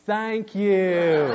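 exaggerated Tennessee accent with a laugh; mock Tennessee accent. Speaker answering the Tennessee girl, mock Tennessee accent. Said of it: "I was mocking her accent to her face".

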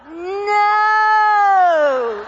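long, loud, high drawn-out no; loud mortified no. Retold or imitated. Parents mortified as their son blunders, a long loud no. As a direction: wail it out in horror.

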